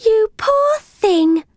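high, slow, pitying coo; sympathy. A narrator's reading. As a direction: draw it out tenderly, high-voiced, to comfort a pet. Girl comforts trapped cat.

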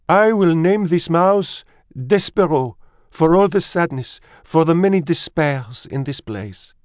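put-on high, dramatic French-accented mouse voice; sniffing French mother. A narrator's reading. Mother names her son for sadness, high and melodramatic. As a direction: declare it like a tragic French diva. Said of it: "She sniffed".

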